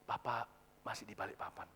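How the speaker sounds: very low, hushed, halting request; reluctant father. Acted. Father phoning to ask a favour for a relative, reluctant and hushed. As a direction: ask softly with pauses, shy to impose. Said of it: "nadanya sungkan".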